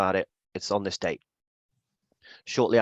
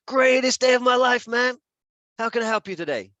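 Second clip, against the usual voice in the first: much higher, shouted cheerful reply; Devon impression; 13 semitones higher, 5 dB louder than usual. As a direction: overflow with enthusiasm. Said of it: "I'm gonna do an impression of you".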